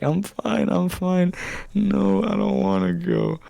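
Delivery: very high, slow tearful whine; mock sobbing whine. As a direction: wail like a scared kid. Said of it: "And I start fucking crying".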